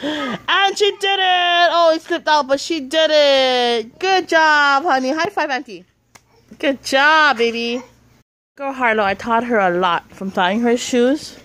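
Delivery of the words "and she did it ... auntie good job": excited praise. Real, not acted. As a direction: gasp and celebrate her success. Child finally ties shoes.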